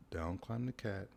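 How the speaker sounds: ordinary delivery, nothing marked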